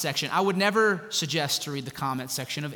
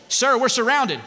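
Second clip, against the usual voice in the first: lightly acted soldier's alarm, high and loud; raised, louder voice of a mock soldier; 9 semitones higher, 8 dB louder than usual. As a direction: report urgently to a commanding officer. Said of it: "uh, a soldier".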